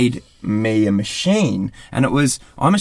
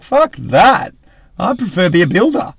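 higher, louder mock disgust; fed-up friend; 8 semitones higher, 4 dB louder than usual. Friends seeing the struggle, mock scornful retort. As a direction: throw it away in disgust. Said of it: "they'll be like".

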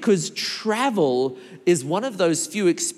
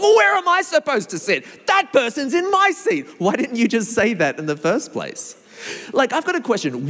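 aggressive passenger; 7 semitones higher than usual. Retold or imitated. Displaced passenger complains to the newcomer, high and indignant. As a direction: whine it out like someone turfed from their seat. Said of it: "now they're aggressive".

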